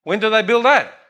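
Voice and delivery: high-pitched mock demanding question; brash American visitor. American tourist challenging his Italian host, mock pushy. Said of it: "The American guy goes".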